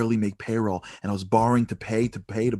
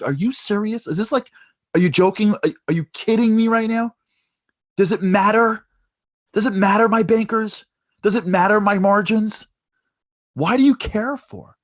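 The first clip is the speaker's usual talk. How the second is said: much higher and louder, exploding; furious disbelief; 11 semitones higher, 7 dB louder than usual. Retold or imitated. Son explodes at the stranger's questions, furious yelling. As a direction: yell it, completely fed up.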